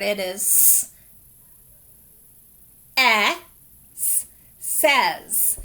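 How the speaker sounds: "s, eh, s" then "says"; sounding out. Shown as a demonstration. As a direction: sound out each part, then blend. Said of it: "In reading this word".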